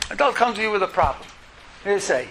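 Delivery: usual speaking voice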